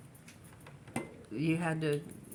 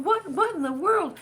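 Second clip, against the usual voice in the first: much higher, louder stunned cry; Sammy Hanna amazed; 14 semitones higher, 10 dB louder than usual. Retold or imitated. Translator marvelling at the Arabic wording, high and loud in astonishment. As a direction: blurt it out in total disbelief. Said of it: "He kept going".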